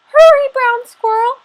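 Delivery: high, loud call from the grandmother. Acted. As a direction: call him home urgently, high and loud. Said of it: "she called".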